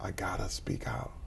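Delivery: whispered to himself; whispered resolve. Retold or imitated. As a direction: quietly make a vow to yourself. His own inner resolution.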